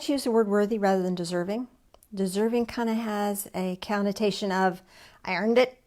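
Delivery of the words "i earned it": mock entitled voice in a southern accent; a southern accent slipping into a louder quote. Preacher's quote drifting into a southern accent. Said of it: "oh, there's that southern accent".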